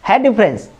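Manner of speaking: ordinary talk, nothing marked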